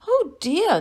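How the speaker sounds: fretful little bird; worried bird. A narrator's reading. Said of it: "Nicky Nightingale thought".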